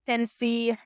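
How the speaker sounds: a light, half-hearted Southern US accent; cringy southern accent. Shown as a demonstration. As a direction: try a Tennessee country accent, a bit awkwardly. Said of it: "my cringy attempt at a southern accent".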